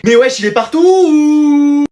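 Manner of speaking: loud, high, with the last word stretched right out; long-drawn exasperated cry; exasperated outburst. Acted. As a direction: complain loudly and hold the final vowel.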